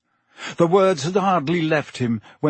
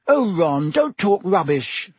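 sharp, higher, impatient retort; snapping; 6 semitones higher, 3 dB louder than usual. A narrator's reading. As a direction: snap it back at him. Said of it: "snapped Hermione".

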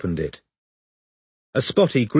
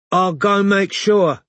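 high, slow, panicky voice; scared stoner backing off; terrified; 10 semitones higher, 4 dB louder, slower than usual. A narrator's reading. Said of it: "looking suddenly terrified".

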